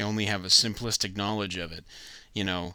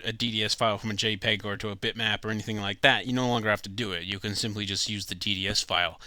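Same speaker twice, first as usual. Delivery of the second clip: ordinary talk, nothing marked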